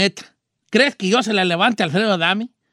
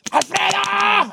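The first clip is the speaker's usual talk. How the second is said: slow, high drawn-out bellow; King Kong yell; 8 semitones higher, much slower than usual. Pretend. Beating his chest, calling Alfredo out like King Kong. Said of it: "Así como King Kong".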